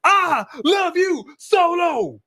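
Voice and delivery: high, drawn-out gushing voice; mock adoring. Mocking how he used to dote on Solo, in high gushing tones. Mimics former affection before switching up.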